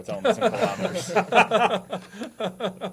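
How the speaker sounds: laughing through it. Acted. Laughing while telling the co-host about the girls. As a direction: crack up while delivering the punchline.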